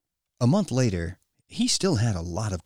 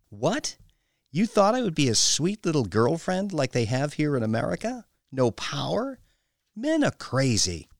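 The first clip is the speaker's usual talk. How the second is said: higher, incredulous scoffing questions; scornful domineering woman; 7 semitones higher than usual. A narrator's reading. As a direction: scoff at his naive expectations. Woman scoffs at his objection.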